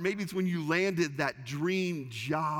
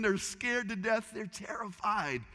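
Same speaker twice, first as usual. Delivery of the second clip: normal talking voice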